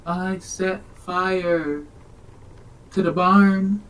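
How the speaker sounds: slow, high, drawn-out mock confession; mock-dramatic slow lament; dazed confession. Acted quote of man watching flames.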